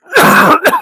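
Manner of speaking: sharp cough, fly stuck in the throat; loud choking cough; just swallowed a fly. Real, not acted. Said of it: "That fly must still be in there".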